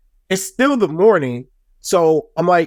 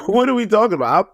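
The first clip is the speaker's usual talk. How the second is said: laughing through the question, pitched up; 5 semitones higher, faster than usual. Real, not acted. Retelling the muffin fight to viewers, laughing in disbelief. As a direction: crack up while repeating it.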